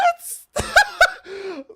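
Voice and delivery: high, loud burst of laughter; shrieking laugh. Real, not acted. Vlogger cracking up at friend's Berlin idea, shrieking laugh. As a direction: cackle helplessly.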